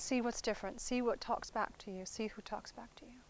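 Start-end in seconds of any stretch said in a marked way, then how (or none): 2.1-3.2 whispered, much softer repeat. Shown as a demonstration. Teacher repeating the point to students in a whisper. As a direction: let the repeat fall to a hush.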